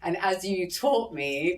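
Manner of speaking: ordinary talk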